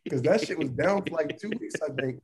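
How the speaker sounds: normal talking voice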